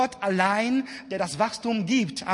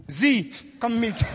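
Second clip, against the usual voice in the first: mock doctor's call; 2 semitones higher, 3 dB louder, slower than usual. Imitating doctor calling her.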